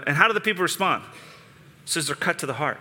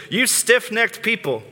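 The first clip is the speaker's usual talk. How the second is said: higher and slow, hammering the rebuke; stern rebuke; 6 semitones higher, slower than usual. Retold or imitated. Preacher voicing Stephen's rebuke, slow and raised. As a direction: Stephen scolding the crowd, read slowly and higher. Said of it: "if you speak like this to people".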